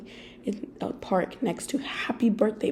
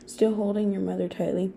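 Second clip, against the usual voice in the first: plain reading voice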